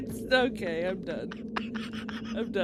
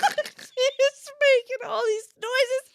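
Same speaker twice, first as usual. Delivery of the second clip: ordinary delivery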